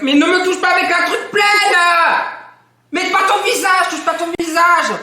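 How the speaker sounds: high, loud panicked shout. Real, not acted. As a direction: yell at her to stop. Friend reaching with dirty hand.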